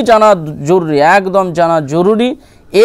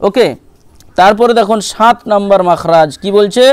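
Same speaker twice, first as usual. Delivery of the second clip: ordinary delivery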